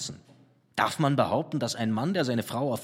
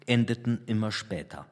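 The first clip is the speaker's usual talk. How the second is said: ordinary delivery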